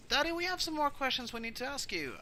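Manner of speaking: high-pitched daughter voice; high, insistent daughter; daughter to Daddy. Acted. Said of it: "Cinder goes".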